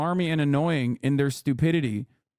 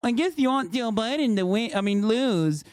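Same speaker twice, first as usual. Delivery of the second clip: higher, sneering mock of a smug troll; 5 semitones higher than usual. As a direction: drip with fake smugness, imitating the commenter. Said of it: "be like".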